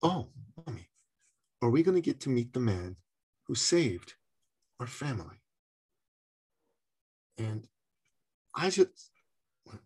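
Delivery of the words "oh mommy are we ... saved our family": put-on soft, solemn child's question, with pauses; very serious voice. Little boy gone quiet asks his mother, very seriously. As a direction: imitate a somber boy, hushed and halting. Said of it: "in a very serious voice".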